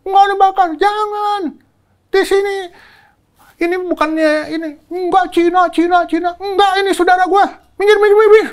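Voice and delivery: high loud yelling at the mob; retold shouting. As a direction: shout them down, pleading for neighbours. (berteriak mengusir massa)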